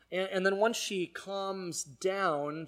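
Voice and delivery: normal talking voice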